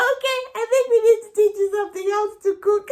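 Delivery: quick, loud, high amused mom voice; amused mother. Retold or imitated. Mother answering her cupcake-fed child, quick amused voice. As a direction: rattle it off like a wry mother. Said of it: "And she said".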